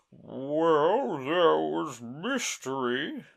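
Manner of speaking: Mock Turtle voice.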